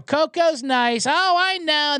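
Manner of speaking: high mock owner voice, stretched out; mock dog owner. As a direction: drawl it like an owner pretending all is fine. Mocking the owner on the trail.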